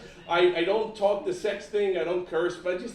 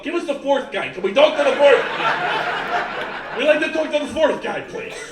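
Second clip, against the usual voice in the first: louder, higher pleading callers; mock caller insisting loud; callers demanding; 7 semitones higher, 7 dB louder than usual. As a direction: beg for the fourth lawyer. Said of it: "People calling up".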